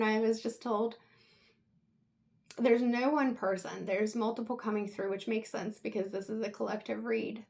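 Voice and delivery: usual speaking voice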